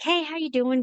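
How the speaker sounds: high, bright hello to a fan; cheerful greeting. Retold or imitated. Greeting a fan at Comic Con, high cheerful hello. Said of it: "I was like".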